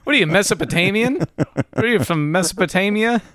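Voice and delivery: high-pitched teasing question, amused; mock disbelief. Real, not acted. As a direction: play up the incredulity. Echoing own joke at friend.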